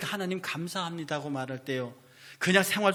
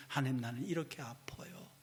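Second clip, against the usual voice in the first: hushed, softer plea to God; whispered confession; 2 semitones lower, 9 dB quieter than usual. Retold or imitated. Believer opening heart to God, whispered confession. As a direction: confide the pain in a whisper.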